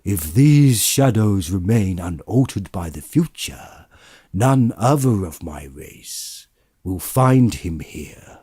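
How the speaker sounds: cold, deliberate spirit mocking his words; stern ghost. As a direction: quote his own cruelty back at him. Ghost throws Scrooge's words back.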